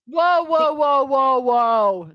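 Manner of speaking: loud, high run of whoas sliding down; playful objection. Real, not acted. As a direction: cut in with mock protest. Playful interrupting objection to a mistake.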